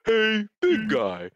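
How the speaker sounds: slow put-on cartoon greeting; a hint of Patrick as a cereal mascot; Patrick as Tony. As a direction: drawl it like a mascot.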